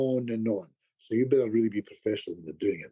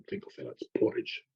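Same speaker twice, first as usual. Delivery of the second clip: hushed correction; 10 semitones lower, 7 dB quieter than usual. Retold or imitated. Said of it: "And I said to him".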